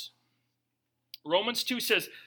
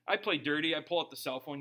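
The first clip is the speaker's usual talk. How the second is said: normal talking voice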